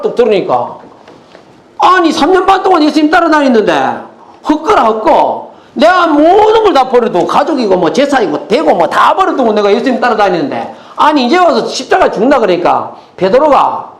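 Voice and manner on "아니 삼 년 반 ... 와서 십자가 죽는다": high, heated voice of an outraged disciple; indignant mock protest. Peter objecting to Jesus about the cross, indignant protest. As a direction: protest in disbelief, pitch pushed up. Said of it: "막 소리 지르면서".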